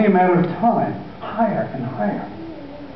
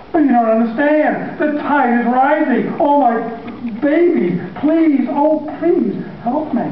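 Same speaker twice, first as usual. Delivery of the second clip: high, pleading sobbed words; desperate father pleading with his sons; begging father; 9 semitones higher than usual. Acted. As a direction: beg them to help.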